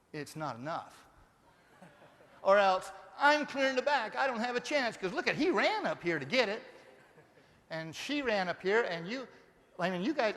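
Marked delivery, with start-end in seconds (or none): 0.1-0.9 lower, softer mock complaint; mock grumble. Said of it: "it'll sound something like this". 3.2-10.3 higher mock whine; mock whiny excuse. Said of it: "it'll sound something like this".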